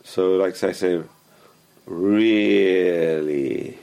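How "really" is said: drawn-out, sarcastic "Really". Shown as a demonstration. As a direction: stretch "Really" long and slow, sarcastically. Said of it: "It's very sarcastic".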